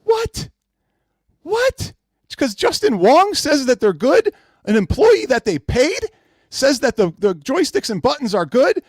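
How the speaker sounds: high, loud, disbelieving what; incredulous mockery. Real, not acted. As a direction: scoff at the very idea. Host mocks the controls defense.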